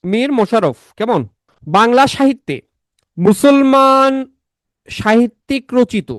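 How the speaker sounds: high-pitched, slow, drilling teacher voice; emphatic lecture. Real, not acted. Quizzes class in lecture voice. (উঁচু গলায় জোর দিয়ে পড়ানো)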